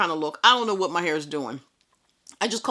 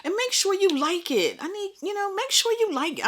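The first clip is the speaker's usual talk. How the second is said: high playful voice; playful squeaky plea; 14 semitones higher than usual. Acted. Vlogger cajoling viewers to like video, high playful squeak. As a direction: coax them in a much higher voice.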